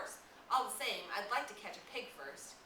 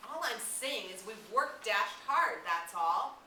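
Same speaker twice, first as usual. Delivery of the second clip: defensive anger; 5 semitones higher, 10 dB louder, slower than usual. Acted. Jack defends himself heatedly.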